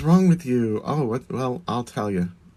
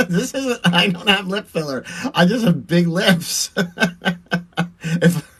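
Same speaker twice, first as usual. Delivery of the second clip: high, laughing protest; 6 semitones higher, 4 dB louder than usual. Real, not acted. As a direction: talk through real giggles, pitched up. Laughs at lip filler comment.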